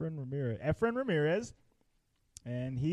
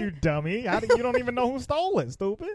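higher, quicker mock exasperation; mock scolding; 8 semitones higher than usual. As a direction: playfully tell off a dim-witted character. Mock scolding a film character.

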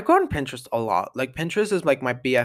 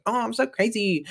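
mock crazy person; 7 semitones higher, 3 dB quieter than usual. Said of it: "a crazy person that's like".

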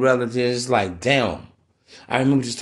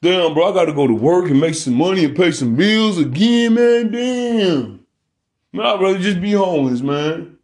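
mock whining man; 3 semitones higher than usual. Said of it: "You don't hear me saying".

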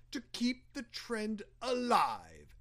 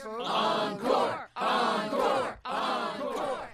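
shouting; slower than usual. Acted. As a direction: call it out to the stage. Crowd calls for more.

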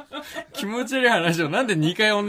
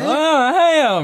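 high, loud cabaret hostess greeting; 11 semitones higher, 6 dB louder than usual. Retold or imitated. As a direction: put on a hostess's breezy greeting. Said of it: "みたいな言ってる".

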